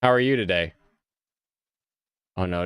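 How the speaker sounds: ordinary delivery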